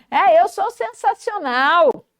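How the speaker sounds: agudo e pausado, cheio de orgulho; elogie-se com gosto; autoelogio animado. Shown as a demonstration. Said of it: "Se elogie também". (entusiasmo de quem se valoriza)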